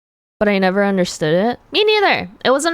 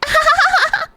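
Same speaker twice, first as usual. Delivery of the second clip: sudden high-pitched cackle; squeaky high laugh. Pretend. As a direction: burst into a squeaky giggle. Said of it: "whenever you made a joke, I was like".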